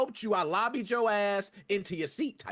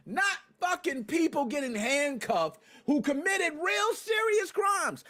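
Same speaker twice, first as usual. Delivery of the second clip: outraged yell about the arrests; furious slow shout; angry shout; 6 semitones higher, slower than usual. Real, not acted. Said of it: "I went off a little bit".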